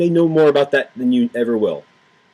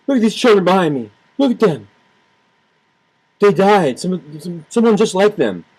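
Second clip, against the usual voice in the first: 1 imitating Obama; 5 semitones higher than usual. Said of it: "Obama stands up there and".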